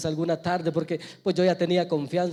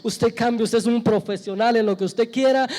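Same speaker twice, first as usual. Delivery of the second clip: insistent preaching that builds louder; impassioned preaching; 2 semitones higher, 5 dB louder than usual. Real, not acted. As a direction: drive each phrase harder, building to a loud peak. Preaches at full force.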